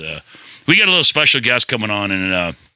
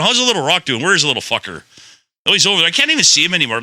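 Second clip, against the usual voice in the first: ordinary delivery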